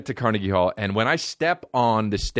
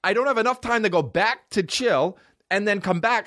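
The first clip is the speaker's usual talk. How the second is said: ordinary delivery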